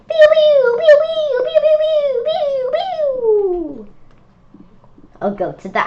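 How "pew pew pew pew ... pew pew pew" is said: very high, loud pew pew sound effects; squeaky laser-gun pews; shooting noises. Pretend. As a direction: fire off toy-blaster pews. Imitating bean shooter bugs.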